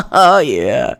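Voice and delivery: normal talking voice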